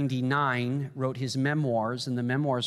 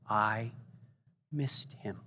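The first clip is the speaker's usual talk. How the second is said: softer, broken voice; weeping; 4 semitones lower, 8 dB quieter, slower than usual. Retold or imitated. Preacher retelling Templeton weeping, quiet broken voice. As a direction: let the words fall away in tears. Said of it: "his shoulders bobbed as he wept".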